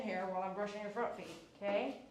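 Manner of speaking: soft, tender cooing to the dog; soft pet talk. Real, not acted. Groomer praising the happy dog, soft and tender. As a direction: murmur kindly to a wagging pup.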